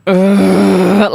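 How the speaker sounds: loud, exasperated ugh; irritated grunt at the wrong answer; annoyed groan. Retold or imitated. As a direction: let out a fed-up groan.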